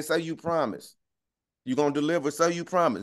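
normal talking voice

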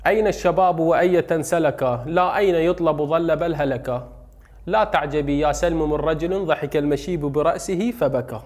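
slow, measured recitation of verse; poem recital. Shown as a demonstration. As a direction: recite the verse slowly with feeling. (إلقاء شعري بطيء)

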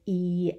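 "E" held long as a pure ee; long E sound. Shown as a demonstration. Teacher saying the vowel sound for children, long drawn-out "E". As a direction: stretch out "E" as one sustained vowel. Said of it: "is the long sound".

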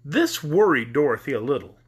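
usual speaking voice

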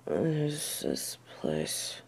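waking dazed, whispering; bewildered whisper. Acted. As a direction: breathe the words, lost and confused. Disoriented waking in strange place.

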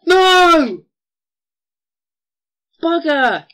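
high, very loud furious shout; angry yell. Real, not acted. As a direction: scream in real frustration. Dies to skeleton, yells.

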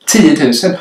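incredulous echo. Retold or imitated. Staff echoing a customer's compensation demand, incredulous. Repeats the outrageous demand in disbelief.

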